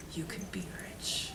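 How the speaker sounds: hushed treasure secret; secret whisper. Acted. Teacher whispering the reward to the listening kids. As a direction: whisper it like a promise.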